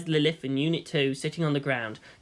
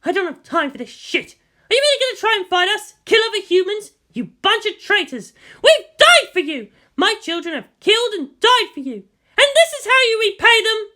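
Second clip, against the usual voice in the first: loud, high, fast outrage; furious; 6 dB louder than usual. A narrator's reading. Misato raging at the general, loud and high. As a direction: hurl the accusation at them.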